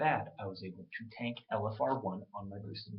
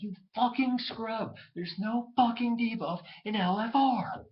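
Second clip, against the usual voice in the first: much higher and louder, slow yelling; slow, raging mock yell; furious rant; 12 semitones higher, 7 dB louder, slower than usual. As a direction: scream it at the scrub, slowly. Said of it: "I'm like".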